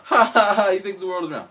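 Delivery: hammed-up mocking crowd; mocking laughter. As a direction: laugh at the fool who says it's round. Mocking people of old times.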